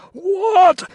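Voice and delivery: very high, loud shriek of outrage; gurgled cry. A narrator's reading. Carstairs is refused by Crow, crying out. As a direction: gasp it out as a shriek.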